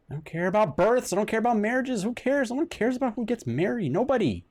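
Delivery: ranting that nobody cares about weddings; exasperated rant. Real, not acted. Streamer ranting at the newspaper's birth and marriage notices.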